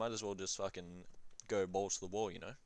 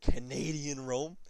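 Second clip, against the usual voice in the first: drawn-out, higher mock-grand sell; slow tempting pitch, a bit higher; hammed-up pitch; 5 semitones higher, 6 dB louder, slower than usual. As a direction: savour the words like a grand promise. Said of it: "Doesn't that sound better?".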